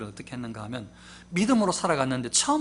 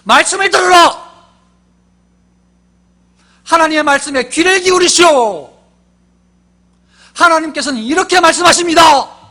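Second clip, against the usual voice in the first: prophet's thundering call to hear the word; shout; 13 semitones higher, 19 dB louder than usual. Shown as a demonstration. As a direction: roar it like a prophet. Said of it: "잘 들리도록 제가 큰 소리로".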